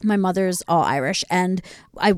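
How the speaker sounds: usual speaking voice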